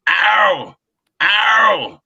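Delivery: put-on light broken Japanese accent on ow; imitating a Japanese friend's pained ow. Imitating Hero groaning to the driver in Japanese accent. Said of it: "in his J-, broken Japanese accent".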